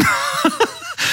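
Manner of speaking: a high, airy burst of laughter; real high-pitched giggle. Real, not acted. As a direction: laugh out, tickled by your own joke. Laugh after mocking quote.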